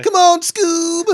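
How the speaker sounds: very high, loud cartoon call; Shaggy. Co-host demonstrating the Scooby-Doo character, high slow holler. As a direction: drag it out, voice pushed way up. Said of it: "I got Shaggy".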